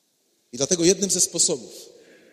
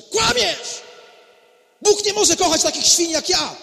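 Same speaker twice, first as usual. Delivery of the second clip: desperate shout; 8 semitones higher than usual. Acted. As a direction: scream it out in despair. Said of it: "i krzyczy".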